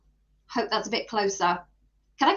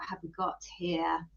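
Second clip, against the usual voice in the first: ordinary delivery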